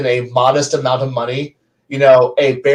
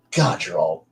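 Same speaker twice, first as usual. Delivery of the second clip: much softer, breathy contempt; disgusted sigh; 6 semitones lower, 8 dB quieter than usual. Acted. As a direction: sigh out the scorn.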